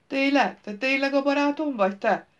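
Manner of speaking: put-on high, childlike disbelief; amazed delight. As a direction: ask it in wide-eyed wonder, pitched high. Fool amazed to be called friend.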